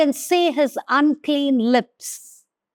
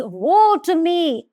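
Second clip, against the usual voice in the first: higher, slow cry of woe; slow anguished cry; 6 semitones higher, slower than usual. Acted. Preacher rereads Isaiah's cry to the church, anguished. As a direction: stretch out the cry of dismay. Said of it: "I cried".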